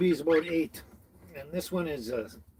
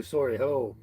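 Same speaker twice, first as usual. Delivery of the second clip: ordinary delivery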